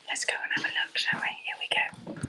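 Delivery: hushed, voiceless whisper; very soft whispered aside; real whisper. Real, not acted. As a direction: whisper so the presentation isn't disturbed. Creeping out past a presentation.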